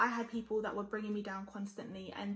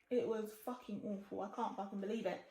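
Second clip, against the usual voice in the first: ordinary talk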